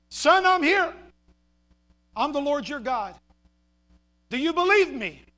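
a hint of God calling back; lightly voiced Lord. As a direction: answer as God, in short spaced phrases. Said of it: "Finally, the Lord responded and said".

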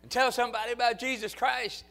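loud, high, fervent revival preaching; full-force preaching. Acted. Pastor exhorting the congregation to go soul winning, full-force preaching. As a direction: shout it out to the congregation.

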